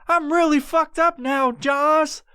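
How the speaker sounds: very high, squeaky comic voice; panicked stoner. Retold or imitated. Stoned man confiding in imaginary friend, panicked. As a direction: wail it high in mock alarm.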